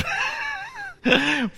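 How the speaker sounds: very high shouting laugh of joy; joyful shouted laugh. Real, not acted.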